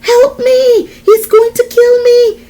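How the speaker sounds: very high, loud scream for help; terrified yell. A narrator's reading. Desiree yelling to John as Xander attacks, terrified scream. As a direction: yell desperately for rescue. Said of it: "I yelled out".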